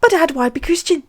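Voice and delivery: put-on high, quick little-boy voice; hammed-up little kid asking Dad; questioning child.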